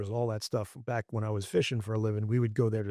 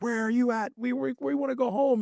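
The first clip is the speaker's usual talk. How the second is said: high-pitched mock whining protest, much higher than usual; pissed-off complaint; 13 semitones higher, 4 dB louder than usual. Angler mimicking pissed-off companions calling him out, high mock complaint. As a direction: imitate an annoyed crew in a raised, high voice. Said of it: "they were pissed. They were like".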